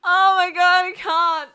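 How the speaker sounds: can barely get the words out for laughing; real helpless laughter; laughing hard. Real, not acted. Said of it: "I'm laughing so much".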